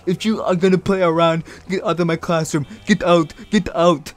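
mock teacher ordering boys out; put-on teacher voice, slowing on get out; lightly voiced teacher kicking students out; imitating the teacher. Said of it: "our teacher stops them and said".

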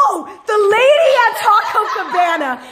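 high, loud burst of exasperation; gasp, then blurt it out high and loud; worked-up, laughing exclamation; exasperated outburst. Real, not acted. Remembering the lady, erupts in exasperation.

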